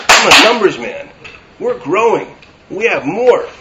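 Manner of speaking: loud, high, driving sermon voice; preaching at full force. Acted. Rabbi railing at the world's obsession with growth, loud preaching. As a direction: hammer each item home to the crowd. Preaching against the numbers mindset.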